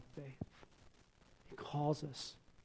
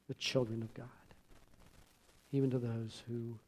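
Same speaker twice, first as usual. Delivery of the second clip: prayer. Acted. Closing prayer.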